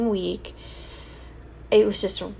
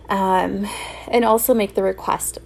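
ordinary talk

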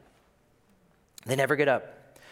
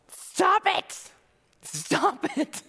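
high mock stop; much slower than usual. Jesus interrupting.

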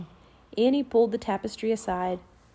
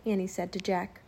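ordinary delivery

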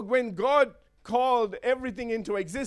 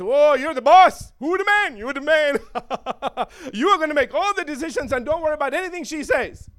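loud, laughing, coaxing tempter; butter the man up, mocking him; flattering devil; 4 semitones higher, 7 dB louder than usual. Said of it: "he speaks to the man and says".

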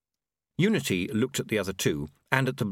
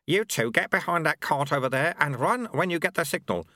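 higher-pitched monk voice giving orders; Lu-Tze, directing; 6 semitones higher than usual. Acted. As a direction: direct the pair briskly in character.

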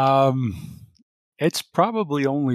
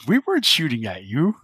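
louder, defensive, swooping protest; Kirsten; 3 semitones higher, 5 dB louder than usual. Acted. Kirsten corrects Cecile over comm, defensive protest. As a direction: push back with a swooping, put-on voice.

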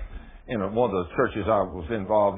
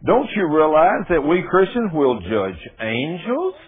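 preaching at full force; 8 semitones higher, 7 dB louder than usual. Acted. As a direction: preach it out to the whole room. Reading scripture at full force.

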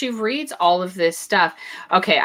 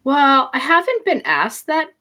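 higher-pitched, imitated hesitant answer; 8 semitones higher than usual. Woman answering a man's blunt question, hesitant. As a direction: act out someone caught out by a simple question.

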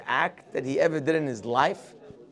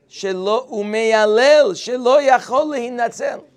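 raised, high and urgent; loud dramatic wail; 6 semitones higher, 5 dB louder than usual. Retold or imitated. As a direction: call it out like a lament. Satan describes the boy screaming.

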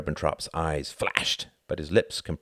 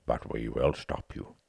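quiet resolve; 5 semitones lower, 5 dB quieter than usual. A narrator's reading. Churchill answering, low and soft. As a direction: lower and softer.

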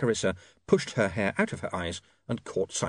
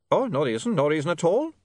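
higher, lilting innocent vampire; innocently; 7 semitones higher than usual. A narrator's reading. Otto backs off teasing William, innocently. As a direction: play innocent in a vampire accent. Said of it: "said Otto innocently".

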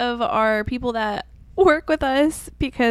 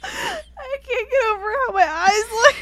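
laughing; 13 semitones higher than usual. Real, not acted. Laughing high.

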